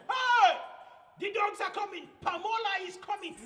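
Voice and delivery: monkey shrieking that the dogs are coming; alarmed shout. Acted. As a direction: scream the alarm across the river. Monkey lookout yells warning.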